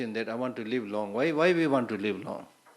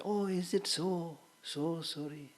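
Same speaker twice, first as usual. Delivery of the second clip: high, soft, drawn-out mock sympathy; perfunctory condolence; 6 semitones higher, 5 dB quieter, slower than usual. Man hearing from ghost friend of a death, mock sympathy. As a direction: coo a hollow "so sorry" in imitation. Mimics casual polite condolence.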